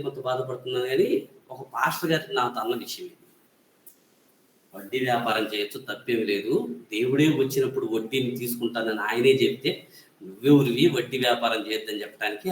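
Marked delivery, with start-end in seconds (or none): 4.7-12.5 challenging question. Retold or imitated. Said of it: "అని నన్ను ప్రశ్నించాడు".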